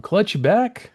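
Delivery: small high voice calling; a tiny voice from offstage; little voice. Retold or imitated. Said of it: "you hear a little voice in the background".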